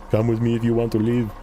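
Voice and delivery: lightly voiced Arnold Schwarzenegger line; a hint of a gruff action hero; mock movie-hero delivery; Arnold impression.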